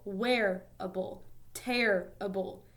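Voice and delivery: WEAR-able, TER-rible, first syllable drawn out; teacher's slow syllable demo of "Wearable, terrible"; stress on first syllable. Shown as a demonstration. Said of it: "the stress is on the first syllable".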